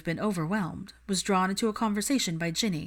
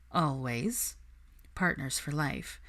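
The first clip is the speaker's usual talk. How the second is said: lower, softer, fond reply; lightly voiced Harry; 3 semitones lower, 5 dB quieter, slower than usual. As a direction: answer her with quiet devotion.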